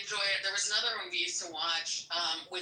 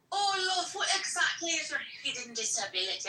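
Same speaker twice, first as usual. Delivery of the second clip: put-on Irish accent; 8 semitones higher than usual. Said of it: "I know I'm not doing the accent".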